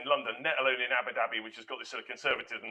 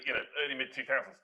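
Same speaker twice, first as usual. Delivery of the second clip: ordinary talk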